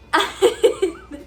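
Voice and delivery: high loud incredulous laugh; burst of laughter. Real, not acted. Laughing at the store director's tantrum, loud laugh. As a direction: let a real laugh burst out.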